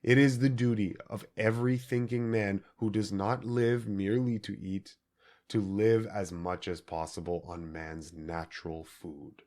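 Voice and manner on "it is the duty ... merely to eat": normal talking voice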